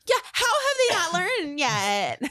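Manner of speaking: high, slow, sarcastic drawl; mock the clueless pranked one; slow high mock commenter. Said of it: "everybody in the comments is like".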